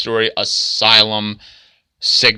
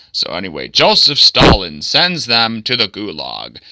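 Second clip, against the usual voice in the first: usual speaking voice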